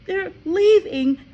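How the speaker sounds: high, loud, slow cry; a child in panic; distressed girl. A narrator's reading. Matilda tells Miss Honey, panicked. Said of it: "she cried".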